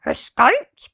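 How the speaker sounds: loud barked question; barking dogs. A narrator's reading. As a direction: bark it out like a surprised dog. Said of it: "barked the dogs".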